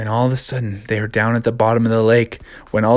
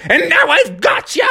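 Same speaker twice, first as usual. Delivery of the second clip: villain laugh. Acted.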